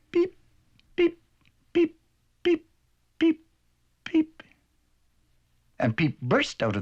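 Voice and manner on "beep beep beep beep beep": high squeaky chick beeps; chick peeping. Acted. Peep in the eggshell peeping to the duck.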